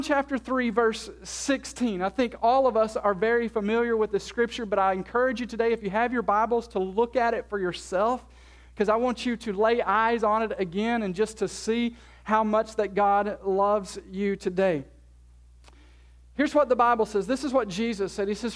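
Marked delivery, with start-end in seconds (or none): none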